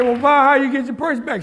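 a delighted, disbelieving ask; amazed father. Retold or imitated. Father asking mother how she recovered purse, amazed.

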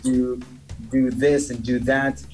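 usual speaking voice

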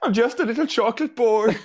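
put-on high silly child voice; mock innocent boy. Joking disguised marine, high and silly. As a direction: play the innocent little boy. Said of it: "joke disguise line".

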